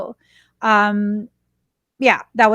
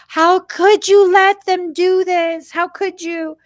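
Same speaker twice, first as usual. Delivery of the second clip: anguished accusation; 7 semitones higher, slower than usual. Retold or imitated. Accusing guides over lover's death.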